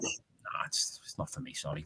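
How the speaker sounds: hushed, breathy whisper; whispered aside. Real, not acted. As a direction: drop to a secret whisper. Aside in a conspiratorial tone.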